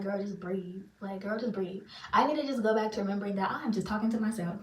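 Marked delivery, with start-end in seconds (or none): 0.0-1.8 self-calming. Retold or imitated. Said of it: "I'm like".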